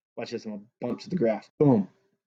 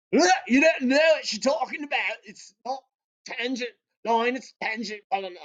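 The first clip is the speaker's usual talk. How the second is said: a hint of a mock Scottish accent, pitched up high; lightly put-on Scottish scolding; 9 semitones higher than usual. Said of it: "Maclaurin was, like, Scottish, so he was like".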